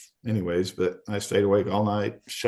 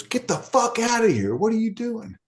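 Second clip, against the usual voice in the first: high mock-yelling cop; guard ordering him out, high and rough; 13 semitones higher than usual. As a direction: bark it like an annoyed guard, pitched way up.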